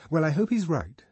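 lightly voiced young woman; Lenina's voice.

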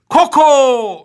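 high, loud, drawn-out call; echo call. Acted. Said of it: "에코 넣을 준비하라고".

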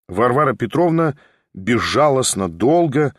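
ordinary delivery, nothing marked

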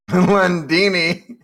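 Lundini repeated slowly with a laugh; laughing echo. Real, not acted. A co-host repeating the name, laughing.

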